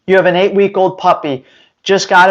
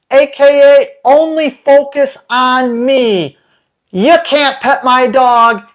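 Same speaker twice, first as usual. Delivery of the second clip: raised, high, slowed and indignant; exasperated rant; 5 semitones higher than usual. Acted. Trainer telling strangers off for touching his dog in training, exasperated. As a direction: tell them off like a protective owner.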